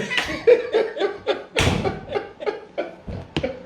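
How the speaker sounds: helpless wheeze of laughter; high breathless laugh. Real, not acted. Cracking up at his mother's accusation, breathless laugh. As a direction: burst into a high airless laugh.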